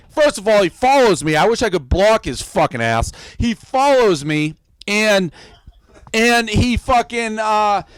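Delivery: ordinary talk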